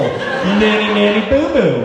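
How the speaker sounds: singsong nanny-nanny-boo-boo taunt; childish taunt. Retold or imitated. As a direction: gloat like a schoolkid, laughing.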